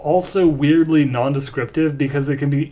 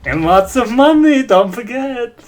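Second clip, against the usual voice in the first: pitched-up character voice; high, loud character line; 7 semitones higher, 4 dB louder than usual. Acted.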